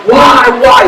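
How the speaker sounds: high-pitched anguished cry, acted; imitated yelling. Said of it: "He was probably yelling".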